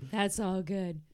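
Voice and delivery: ordinary delivery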